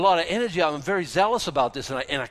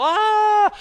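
short high loud hysterical cry; hysterical shriek; 11 dB louder than usual. Shown as a demonstration. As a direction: squeal it like you've lost it. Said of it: "wanna sound like, you know, hysterical".

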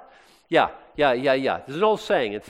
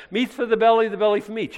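higher, louder mock Corinthian slogan; 8 semitones higher, 4 dB louder than usual. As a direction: chant the slogan like a smug Corinthian.

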